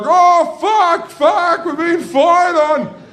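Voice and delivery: high, loud panicked scream; shrieking under fire; freaking out. Acted. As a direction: scream the alarm in comic panic. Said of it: "I fucking freak out".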